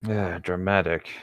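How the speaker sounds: ordinary talk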